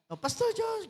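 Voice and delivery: high, wailing mock sob; fake-cry the name; hammed-up crocodile tears. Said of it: "Iyak-iyakan lang pala".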